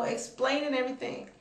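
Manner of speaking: normal talking voice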